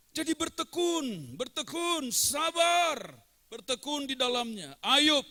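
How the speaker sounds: loud, high fervent sermon; preaching at full force. Acted. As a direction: build and proclaim to the congregation. Preaching exhortation.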